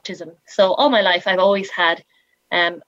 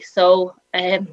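ordinary talk

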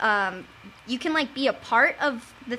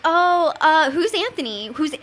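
higher and louder hammed-up mom; exaggerated mock mom; 5 semitones higher, 5 dB louder than usual. Daughter hamming up her mom quizzing her, higher and louder. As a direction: overdo the suspicious mother. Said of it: "well, not in that voice".

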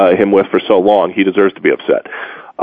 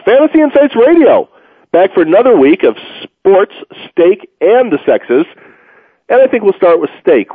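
radio announcer; 7 semitones higher than usual. Acted. Radio show intro.

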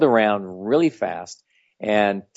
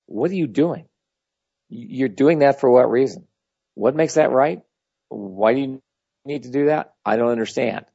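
higher, probing questions; urgent puzzled challenge, raised pitch; intense questioning; 4 semitones higher than usual. Shown as a demonstration. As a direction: press them with curious intensity, pitched up. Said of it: "you do that with people as well".

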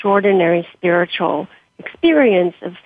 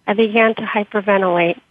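ordinary delivery, nothing marked

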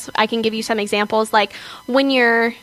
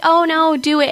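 encouraging teacher; 7 semitones higher, 4 dB louder than usual. Retold or imitated. Teacher urging her to perform.

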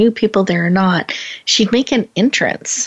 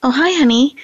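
imitating husband's casual greeting; 7 semitones higher than usual. Host imitates husband barely looking up, offhand. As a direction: toss it off like a distracted husband. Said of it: "he goes".

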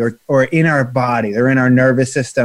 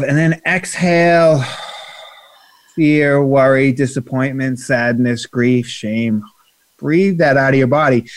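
guided breathing. Acted. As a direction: ease the listeners into letting go.

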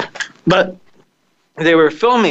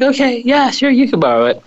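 put-on girl voice; 11 semitones higher than usual. Angie voiced in story.

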